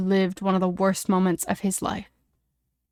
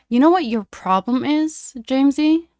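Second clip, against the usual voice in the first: higher, louder taunting question; sharp and loud; 6 semitones higher, 6 dB louder than usual. A narrator's reading. Snape breaking the tense silence, sharp and loud. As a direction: challenge him sharply, voice lifted. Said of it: "His voice was sharp, loud enough".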